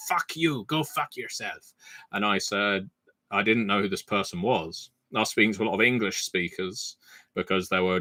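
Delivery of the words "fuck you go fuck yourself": put-on French accent, cheerful insult falling away quieter; imitating a French guy telling him off; light French accent on a friendly insult. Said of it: "as you can tell from the accent".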